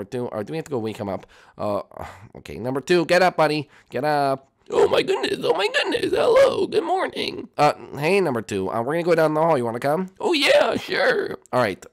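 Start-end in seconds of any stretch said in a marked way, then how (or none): 4.7-7.5 high yelping cartoon voice; shrill woken-up squeal. Number two woken up. 10.2-11.4 very high, eager yelp; high cartoon yes; high squeaky agreement. Number two agreeing.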